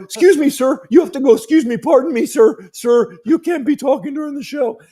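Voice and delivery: put-on high, meek kid's voice pleading politely; squeaky nervous teenager asking him to leave; polite teen bouncer begging a drunk to go; timid teen bouncer.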